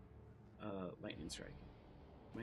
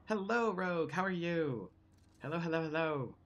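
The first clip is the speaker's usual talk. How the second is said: loud, high cheery hello; excited greeting; 7 semitones higher, 7 dB louder than usual. Real, not acted. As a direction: greet everyone with big energy. Streamer greets the chat.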